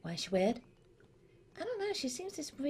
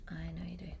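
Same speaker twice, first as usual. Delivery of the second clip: soft whispered echo; breathy whispered repeat; 9 semitones lower, 9 dB quieter, much faster than usual. Real, not acted. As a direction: whisper it back teasingly.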